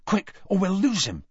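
high, hurrying voice; urgent. A narrator's reading. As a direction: urge them on, pitched high. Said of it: "speeding up".

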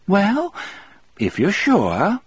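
lightly voiced old lady offering tea; cheerful woman. A narrator's reading. Melinda voiced.

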